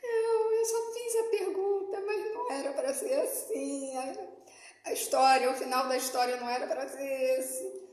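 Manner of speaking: half-sobbing prayer, voice breaking upward; tearful plea. Retold or imitated. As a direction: beg through retold tears. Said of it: "E aí chorando eu falei".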